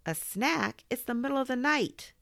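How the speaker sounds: high-pitched outraged echo; exasperated bedtime objection; incredulous. Acted. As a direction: repeat it back in disbelief, voice climbing. Boy incredulous at request.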